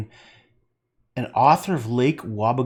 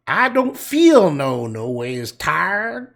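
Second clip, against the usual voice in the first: fake hillbilly accent; 4 semitones higher, 7 dB louder, much slower than usual. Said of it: "she faked the accent of wherever hillbilly country".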